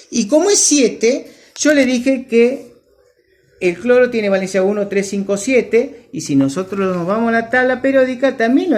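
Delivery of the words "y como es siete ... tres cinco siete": ordinary talk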